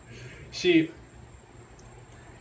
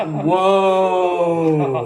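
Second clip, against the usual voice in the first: stunned whoa; 2 semitones higher, 8 dB louder than usual. Retold or imitated. Reacting to old lady remark.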